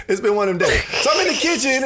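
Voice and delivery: high laughing shout through the words. Real, not acted. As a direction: laugh it out in disbelief.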